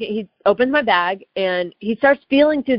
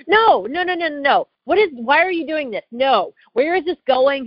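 high-pitched, rapid-fire objection; alarmed protest; 7 semitones higher than usual. Retold or imitated. Traveler objecting to agent photographing passport, alarmed indignant protest. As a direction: refuse indignantly, pitch jumping up.

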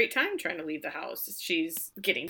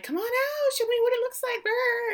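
much higher sing-song coaxing; mock chirpy Rose; 5 dB louder than usual. Rose coaxing Shirley from the dressing room, high chirpy mock. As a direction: chirp it like an eager friend.

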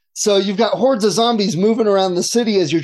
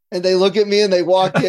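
ordinary talk, nothing marked

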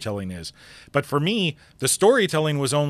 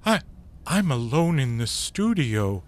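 mock panic; 6 semitones higher than usual. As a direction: cry out as a friend fades away. Acting vanishing friend panic.

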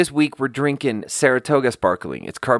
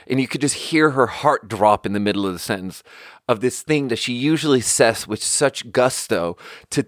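ordinary delivery

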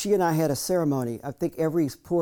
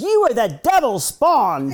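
put-on slow, louder, higher scolding old woman with a laugh in it; stern old maid; 8 semitones higher, 9 dB louder, slower than usual. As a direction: scold him slowly, half laughing. Maid scolding the grown boy.

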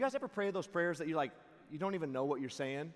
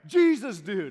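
loud, high holler; imitating a man hailing from afar; calling across distance; 6 semitones higher, 14 dB louder, much slower than usual. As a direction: call it out across a skate park. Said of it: "I hear from the other end of the skate park".

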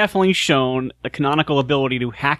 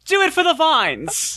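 acted yell; 14 semitones higher than usual. Player in character yells to Millie while punching the guy, high acted yell. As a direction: yell it out in a high mock battle cry. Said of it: "I actually yell to Millie".

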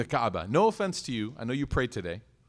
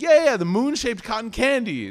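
higher, louder, laughing backpedal; laughing cover-up; 7 semitones higher, 5 dB louder than usual. Retold or imitated. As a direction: laugh it off as you cover your mistake. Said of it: "I was like".

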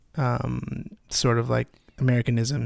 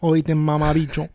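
loud, higher Puerto Rican street slang; Puerto Rican accent; 4 semitones higher, 4 dB louder than usual. Pretend. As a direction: Puerto Rican accent, sounding vaguely Russian. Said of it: "you sound Russian".

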